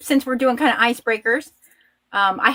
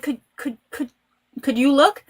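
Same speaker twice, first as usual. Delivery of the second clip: starstruck stammer; 5 semitones higher than usual. Retold or imitated. Starstruck, asking wife to look.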